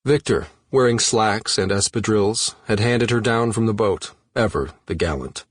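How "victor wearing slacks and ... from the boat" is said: ordinary delivery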